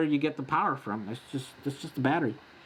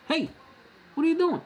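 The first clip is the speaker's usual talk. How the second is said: high, playful dog-directed voice; pitched way up to chat to a pet; talking to the dog; 13 semitones higher, faster than usual. Real, not acted. Host turns to his dog, pitched way up and playful.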